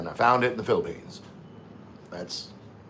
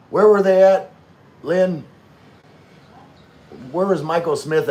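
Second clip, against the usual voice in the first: loud call across the room, pitched up; raised voice calling to wife in another room; 7 semitones higher, 7 dB louder than usual. Real, not acted.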